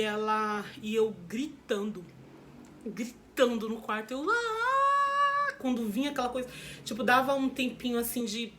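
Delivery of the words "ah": high, loud pained scream; a retold cry of agony; labor scream. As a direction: scream out as the contraction hits.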